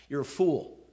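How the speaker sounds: ordinary delivery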